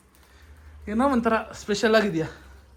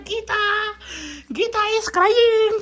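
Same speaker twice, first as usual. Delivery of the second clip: mock announcement; 8 semitones higher, much slower than usual. Son mockingly announces mother crying.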